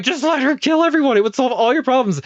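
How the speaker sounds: high, loud exasperated plea; exasperated shout. Retold or imitated. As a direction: yell it out, fed up and eager. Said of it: "You're like".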